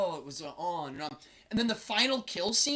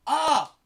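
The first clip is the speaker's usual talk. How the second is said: laughing yelp; 8 semitones higher, 11 dB louder than usual. Real, not acted. Laughing at how boring the film was, loud yelp.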